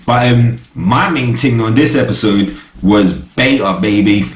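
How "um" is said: usual speaking voice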